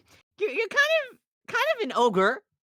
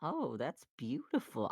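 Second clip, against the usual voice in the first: soft, slow and quieter; touched; 8 semitones lower, 11 dB quieter, slower than usual. Acted. Donkey touched by Fiona's spell, soft and moved. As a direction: breathe it out, moved.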